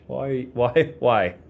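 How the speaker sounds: normal talking voice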